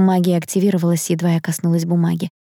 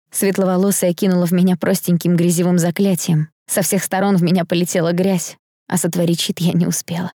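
usual speaking voice

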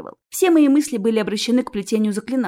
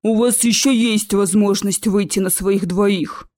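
slow, heavy troll order; 4 dB louder, slower than usual. Acted. Troll tells students to leave, slow and imposing. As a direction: order them out as a lumbering troll.

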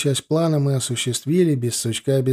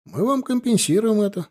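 ordinary delivery

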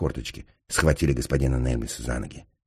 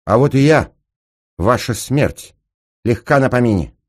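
high, loud, theatrical entrance line; bold announcement; 8 semitones higher, 8 dB louder than usual. Acted. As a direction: announce yourself loudly to the whole room. Woman announces herself to the room. (громко, с вызовом)

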